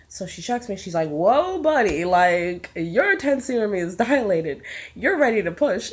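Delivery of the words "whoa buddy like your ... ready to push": loud, raised-pitch retold astonishment; astonished nurse. Nurse telling a labouring mother she's fully dilated, astonished. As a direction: imitate an urgent, startled announcement.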